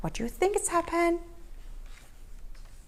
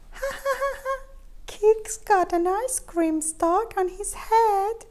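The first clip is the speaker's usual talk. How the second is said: high, laughing cat taunt; mock chuckle; 7 semitones higher than usual. A narrator's reading. Other cats mocking Keith with a high chuckle. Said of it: "chuckled the other cats".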